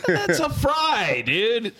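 laughing through the words; amused, cracking up. Real, not acted. Friends joking about fries.

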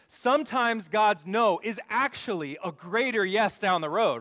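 loud, high, punchy restatement; emphatic wake-up repeat. Shown as a demonstration. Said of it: "You guys are still waking up".

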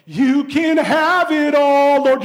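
slow, loud, hammed-up weepy surrender; mock teary vow, drawn out; mock tearful prayer. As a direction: play a sobbing convert giving it all. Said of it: "You're just snot and tears".

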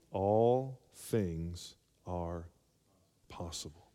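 solemn preaching drawl; slow emphasis. Acted. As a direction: stretch each word to land it. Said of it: "preacher slows for emphasis".

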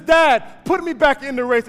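loud, high, desperate pleading; son pleading. Acted. Injured runner begging his father to put him back, loud high and desperate. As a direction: beg your father to get you back in.

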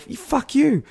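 high-pitched acted retort; retorted insult. Runner answering the driver, high-pitched angry retort. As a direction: throw the insult back, high and indignant. Retold roadside exchange of insults.

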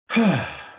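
heavy audible exhale; weary sigh. Real, not acted. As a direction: let out a big sigh. Sighs before dreaded match topic.

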